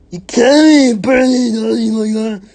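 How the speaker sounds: high, very loud squawk; put-on boy character. A narrator's reading. Grubs butts in after Bertha leaves, high loud squawk. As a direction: squawk it out, overacted.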